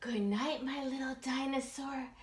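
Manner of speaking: soft, gentle mama; tender goodnight. Acted. Mama saying goodnight to her son, soft. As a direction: breathe it out sleepily. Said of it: "said Mama with a sigh".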